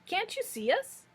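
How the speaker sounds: high-pitched little piglet. A narrator's reading. Piglets to the wizard who cannot see them, high piglet voice. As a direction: pipe up high as a small piglet.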